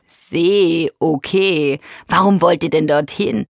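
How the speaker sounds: lightly voiced grumbly hippo; a hint of a ponderous big animal; rumbling hippo. A narrator's reading. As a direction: rumble it thoughtfully. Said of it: "Helmut brummt".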